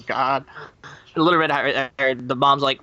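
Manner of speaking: ordinary talk, nothing marked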